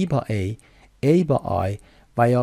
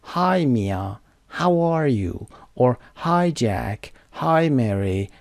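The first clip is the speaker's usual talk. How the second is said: ordinary talk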